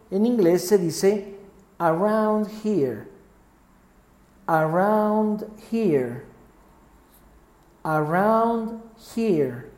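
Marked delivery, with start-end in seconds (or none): 1.8-9.7 "around here" drawn out very slowly; slow, stretched pronunciation drill; slow teaching model. Shown as a demonstration. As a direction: enunciate each word slowly for learners to repeat. Teacher models phrase for repetition.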